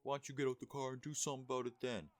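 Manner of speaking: high, loud put-on taunt; mock challenger. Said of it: "he was like".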